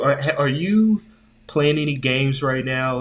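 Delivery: usual speaking voice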